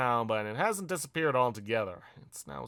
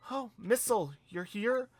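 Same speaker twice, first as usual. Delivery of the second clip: high character voice; 8 semitones higher than usual. Acted. [name] and child character talk.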